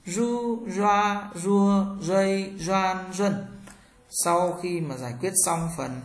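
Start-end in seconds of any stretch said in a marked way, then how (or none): 0.0-3.5 "Ru, roa, rua, rây, doan, ruân" with curled tongue and vibrating cords; buzzed r on "Ru, roa, rua, rây, doan, ruân", high level tone; voiced retroflex r. Shown as a demonstration. Teacher drills r for learners, "Ru, roa, rua, rây, doan, ruân" voiced and curled. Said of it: "R cong lưỡi và dây thành rung".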